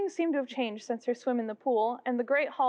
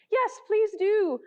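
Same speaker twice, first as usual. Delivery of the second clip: chorus pleading; 9 semitones higher, 4 dB louder, much slower than usual. A narrator's reading. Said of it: "the others all joined in chorus".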